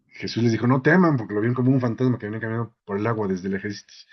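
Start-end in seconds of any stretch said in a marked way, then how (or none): 0.7-1.2 loud, high reassuring call; Jesus calling to frightened disciples, high loud shout; retold shout. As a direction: shout across the water to calm them. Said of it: "porque se gritaron".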